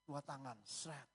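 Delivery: ordinary talk, nothing marked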